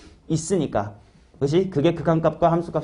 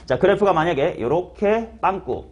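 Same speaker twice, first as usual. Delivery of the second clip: normal talking voice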